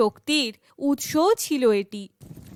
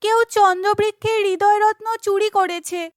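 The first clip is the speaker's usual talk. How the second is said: put-on high, urgent girlish voice; alarmed heroine; 7 semitones higher than usual. Chandrika tells friends of the theft, high and grave. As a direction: break the bad news in a raised voice.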